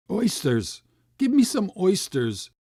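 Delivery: loud high desperate cry. A narrator's reading. Starving boy crying out for oysters in the street. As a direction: beg with everything you have. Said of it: "was the cry that broke from me".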